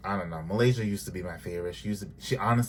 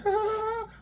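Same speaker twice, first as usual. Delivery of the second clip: mock giddy laugh. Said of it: "[name] was like".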